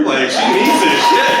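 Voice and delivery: high, slow shout; shouted plea. Real, not acted. Comic shouting to the crowd about the nurse, high and drawn out. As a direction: yell at the crowd to clap for her.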